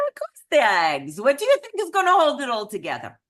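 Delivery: loud, hammed-up Eastern European accent, speeding up; exasperated cook with a terrible Eastern European accent; bad Eastern European accent. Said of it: "I do the absolute worst Eastern European accent".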